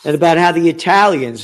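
normal talking voice

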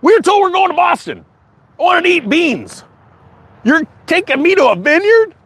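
high, indignant mock complaint; mock migrants. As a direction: squeak out the outrage. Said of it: "Three immigrants go".

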